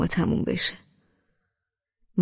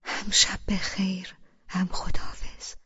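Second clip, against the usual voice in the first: whispered murmur. A narrator's reading. Husband saying good night to sleepy wife, intimate whisper. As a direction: whisper it right into the ear. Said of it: "توی گوشم زمزمه کرد".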